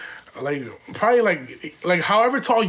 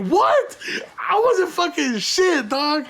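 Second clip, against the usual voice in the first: high squeaked what; startled disbelief, pitched way up; shocked; 10 semitones higher, slower than usual. Retold or imitated. Accused employee answers the husband, shocked.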